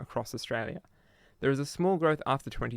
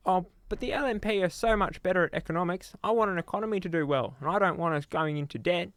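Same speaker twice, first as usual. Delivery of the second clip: higher-pitched mocking impression of a voter; mock objecting voter; 6 semitones higher than usual. Monologuist mimics a voter defending the party's economics, mocking. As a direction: parrot the other side in a raised put-on voice.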